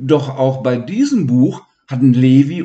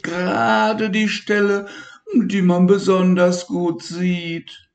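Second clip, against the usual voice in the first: high whiny little voice; whining; 9 semitones higher than usual. Acted. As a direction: wail that the colour is gone. Elmar keeps lamenting.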